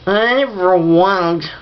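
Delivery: slow, slurred words; drunk-sounding mumble from an injured mouth; slurred speech. Acted. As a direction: slur it like you can't move your tongue. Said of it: "I sound drunk".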